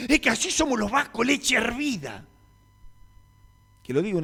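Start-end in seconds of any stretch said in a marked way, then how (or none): 0.1-2.2 much higher caricature boss voice; mock hot-headed Basque. The pastor mocks the boss's excuse in a higher caricature voice.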